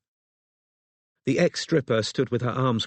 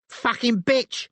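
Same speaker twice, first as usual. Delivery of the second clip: high, furious scream; 13 semitones higher, slower than usual. A narrator's reading. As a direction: scream it in rage. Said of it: "screamed Elisa".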